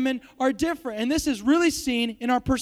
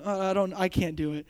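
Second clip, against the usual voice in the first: usual speaking voice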